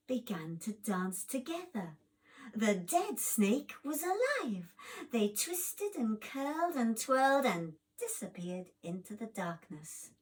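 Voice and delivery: plain reading voice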